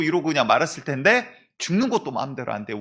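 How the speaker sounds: usual speaking voice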